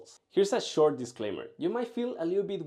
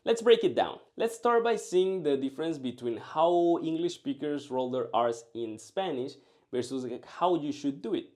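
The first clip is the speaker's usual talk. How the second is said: normal talking voice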